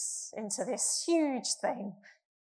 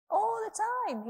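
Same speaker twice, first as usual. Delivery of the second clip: much higher, calling out the church chant to the congregation; call-and-response; 12 semitones higher, 4 dB louder than usual. Acted. Preacher calls the morning refrain back to the congregation, high and ringing. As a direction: lead the room in the refrain.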